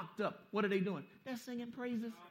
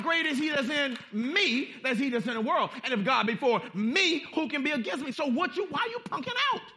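fiery preaching; 5 semitones higher, 5 dB louder than usual. Acted. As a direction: preach it out with force. Preaching at full force.

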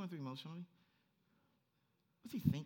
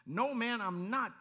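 loud, high-pitched denial; sharp retort; 7 semitones higher, 8 dB louder, much slower than usual. Acted. Peter retorting to his accuser, sharp retort. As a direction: fire it back loud and high. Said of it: "Peter retorted".

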